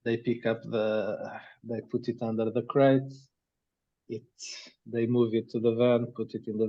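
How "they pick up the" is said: ordinary delivery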